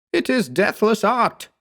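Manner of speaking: high, defiant shout. A narrator's reading. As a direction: fling it after him. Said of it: "he shouted at me".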